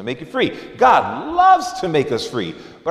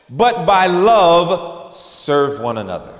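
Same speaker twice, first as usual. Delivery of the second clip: slow scripture emphasis; 3 dB louder, slower than usual. Acted. Preacher reading scripture slowly.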